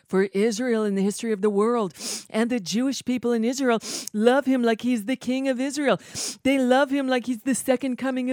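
a mock-adoring, loud recital; mock gushing quote. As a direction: ham up the fawning praise as if mocking it. Reading a fawning quote aloud.

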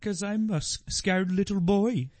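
put-on high, timid Swedish-accented voice; mock Swedish accent. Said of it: "like, a Swedish guy".

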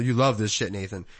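normal talking voice

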